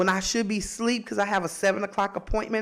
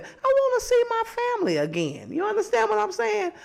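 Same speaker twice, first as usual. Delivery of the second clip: high preachy pitch; 14 semitones higher than usual. Acted. Preaching about seeing family.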